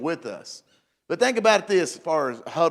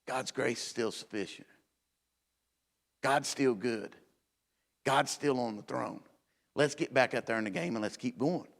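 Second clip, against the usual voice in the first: reassuring; 3 semitones lower, 8 dB quieter than usual. Real, not acted. Quiet reassurance in sermon.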